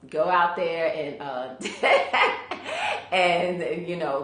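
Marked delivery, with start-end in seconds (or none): 1.6-3.1 rising airy laugh; short amused laugh. Real, not acted. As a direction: laugh lightly at herself. Laughs mid plug.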